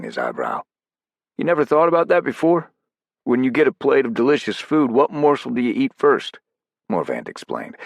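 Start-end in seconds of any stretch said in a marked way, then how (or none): 1.4-6.4 voiced operator, pitched up; character voice, higher and louder. Narrator voicing Morvant's dialogue.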